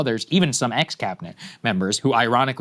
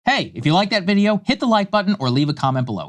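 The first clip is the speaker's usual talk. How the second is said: upbeat, louder, higher sign-off pitch; outro promo read; 10 semitones higher, 5 dB louder than usual. Acted. Host signing off the video, asking viewers to like, upbeat promo read. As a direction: sell the like button with a bright push.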